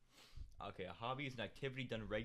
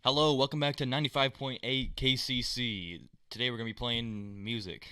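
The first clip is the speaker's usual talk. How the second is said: slow, loud radio DJ announcement; 10 dB louder, slower than usual. Shown as a demonstration. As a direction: boom it out like a radio host. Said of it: "Is that a good impression of r-, what radio sounds like".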